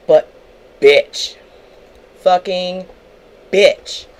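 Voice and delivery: mad and spicy, each word set apart; real anger. Real, not acted. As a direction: punch out each word with pauses, genuinely mad. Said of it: "Y'all can tell I'm mad".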